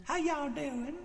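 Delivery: high, soft southern-girl impression; southern accent. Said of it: "the girl with the southern accent".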